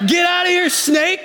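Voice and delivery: high laughing yell at a snake; half-laughing, shout the snake away; laughing mock shout. Husband reenacting wife shooing the snake, laughing high yell. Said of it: "picks up a four-foot stick and is like".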